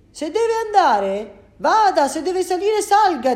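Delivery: loud, slow, high angry driver; angry bus driver. Acted. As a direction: bark the rules at him, slowly and loud. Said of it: "Il conducente era tutto arrabbiato".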